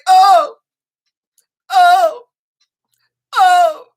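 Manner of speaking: loud pained groans, over and over; groaning on the bike. Retold or imitated. Woman on her exercise bike groaning loudly. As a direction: moan out each oh as you pedal hard. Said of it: "I would be like".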